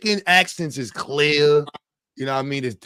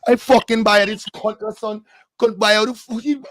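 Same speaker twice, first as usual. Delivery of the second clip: mock Trini accent; 6 semitones higher than usual. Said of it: "You talk to a Trini".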